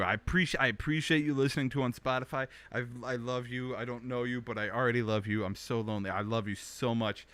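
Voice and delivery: usual speaking voice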